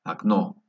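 "Окно" as ak-NO; "Окно" with the first O as A, stressed ending; stress on second O. Shown as a demonstration. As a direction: open "Окно" on A, weight on the final O. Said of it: "fuerza de voz en la segunda O".

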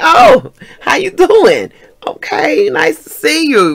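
high, drawn-out, overdone hello; startled greeting. Retold or imitated. Grabbed by the older man, startled greeting. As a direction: burst out loud and high, then ease off.

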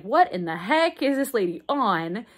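ordinary talk, nothing marked

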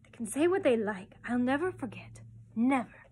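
put-on emphatic, defiant child's vow; fierce. A narrator's reading. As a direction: swear it with force on each phrase. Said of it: "called softly and fiercely to Barbara".

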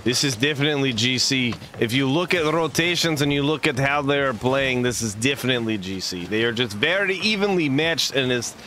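mock Russian accent calling the game; playful Russian caster voice, dropping the articles. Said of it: "Russian, I love doing Russian".

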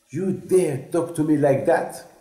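an outraged emperor roaring at an insult; angry scream. Retold or imitated. Napoleon screaming at Moïse over the question. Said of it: "He started to scream to him".

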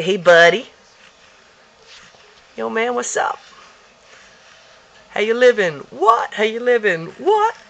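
high, loud cool-dude dog voice; voicing the dog. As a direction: speak for the pet like a homeboy.

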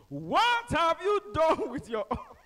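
high, loud, slow pulpit challenge; booming preacher shout. Retold or imitated. Pastor demanding an answer from the crowd, booming shout. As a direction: thunder the question at the congregation. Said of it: "The man asked again".

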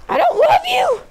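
very high falsetto mock rejection; mock squeaky outburst. Mock scolding of pitiful zombie screams.

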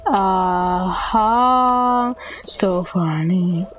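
slow, deadpan mock laughter; badly faked laughing fit; sarcastic fake laugh. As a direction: spell out each ha without amusement. Fake laughing at others' jokes.

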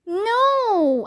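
high, drawn-out cry of protest; mock-horrified, high-pitched no; shout. A narrator's reading. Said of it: "I shouted".

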